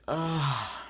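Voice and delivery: soft acted yawn; sighing, imitated yawn. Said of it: "Asa yawns and says".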